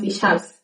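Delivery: ich hab's with es clipped onto hab; quiet casual ich hab's; reduced form. Shown as a demonstration. Teacher shows the spoken form.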